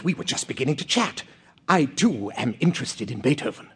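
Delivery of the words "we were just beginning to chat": ordinary talk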